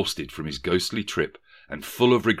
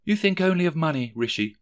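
higher and fast, a hint of a hurt girl; lightly voiced girlfriend; 9 semitones higher, faster than usual. A narrator's reading. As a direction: reproach him quickly, lightly voiced. Girl reproaches Rishi.

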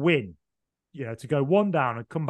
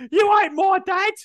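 much higher, slow, strained voice; hammed-up Cockney child's last words; dying child; 5 dB louder, slower than usual. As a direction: squeak it out as a dying Tiny Tim. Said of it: "look at Bob Cratchit and go".

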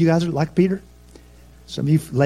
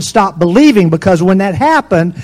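louder, higher preaching at full force; fiery preaching; 4 semitones higher, 5 dB louder than usual. Acted. Preacher rousing the church about fear. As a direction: drive the point home to the congregation.

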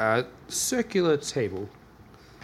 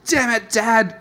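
shouted outburst; 12 semitones higher, 8 dB louder than usual. Pretend. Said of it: "you to be like".

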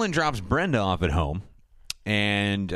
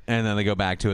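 usual speaking voice

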